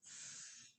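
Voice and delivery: just the f sound, breathy and soft; isolated sound. Shown as a demonstration. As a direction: let only the first sound out. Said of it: "we could hear f".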